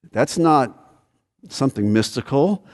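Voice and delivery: ordinary talk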